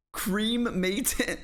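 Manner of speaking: "Cremated" split high as "CREAM-may-tid"; mocking American pronunciation. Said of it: "you do not say it like that".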